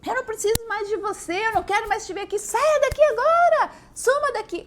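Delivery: high, loud shout of rejection; mother screaming her child out of the house; furious mother. Retold or imitated. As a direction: order them out at the top of your voice.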